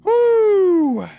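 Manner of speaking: high, piercing celebratory woo; excited whoop. Real, not acted. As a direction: whoop with joy. Celebrating in game.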